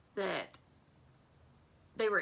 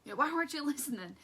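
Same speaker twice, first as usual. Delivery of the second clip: high scolding mama voice; mama fit; 7 semitones higher, faster than usual. Retold or imitated. Mother scolding her lost son, high and quick. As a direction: scold him like a fed-up mother. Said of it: "she's like".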